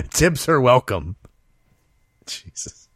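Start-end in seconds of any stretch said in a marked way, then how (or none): 2.3-2.9 breathless whispered exasperation; whispered jesus. Real, not acted. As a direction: breathe it out in disbelief. Disbelieving aside.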